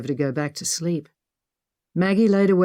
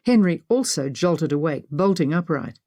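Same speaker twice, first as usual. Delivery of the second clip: ordinary delivery, nothing marked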